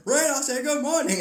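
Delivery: high, loud insistent yell; mock parental bellow; nagging parent. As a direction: shout the order, playing exasperated. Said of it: "like you got a kid".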